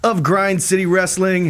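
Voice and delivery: slow, high booming intro; announcer voice. Acted. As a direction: draw it out like a ring announcer. Podcast intro hype.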